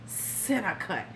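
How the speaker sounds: slow, soft leering murmur; quiet mock come-on, drawn out; creepy imitation. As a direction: savour the words like a creep. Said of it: "he says it like that".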